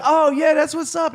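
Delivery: very high, loud delighted whoop; party guys thrilled at the bottle, high and loud; excited partiers. Retold or imitated. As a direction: cheer as the Hennessy appears. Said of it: "they're like".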